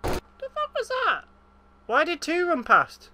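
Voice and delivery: very high startled cry; startled outburst. Real, not acted. Player startled by something rushing past, yelping. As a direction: yelp in surprise.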